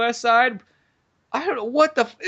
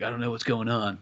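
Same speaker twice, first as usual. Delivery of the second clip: low, soft, clueless hero; mock main-guy voice; 6 semitones lower, 7 dB quieter than usual. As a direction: imitate the leading man, pitched down and quiet. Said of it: "the main guy is like".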